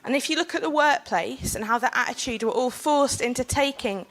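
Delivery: earnest public speech to a panel; formal speech. Acted. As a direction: speak it like a prepared talk. Delivers prepared panel speech.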